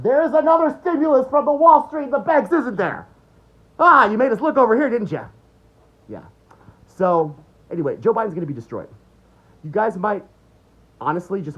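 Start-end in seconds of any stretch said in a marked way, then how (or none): 0.0-5.3 much higher, louder sarcastic taunt; sarcastic mock voice. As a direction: sneer like you caught them out. Mocking the distraction trick.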